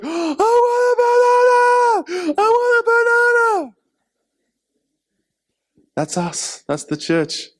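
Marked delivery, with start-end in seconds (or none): 0.0-3.7 very high, loud, drawn-out wail; screaming toddler. Retold or imitated. Preacher imitating a baby demanding a banana, wailing tantrum.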